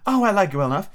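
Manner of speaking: put-on a young girl, politely; Alice voice.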